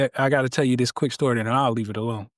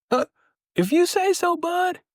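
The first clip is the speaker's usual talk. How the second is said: much higher, skeptical shrug; doubtful rescuer. Acted. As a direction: if you say so, then leave. Joke: rescuer leaves doubtful.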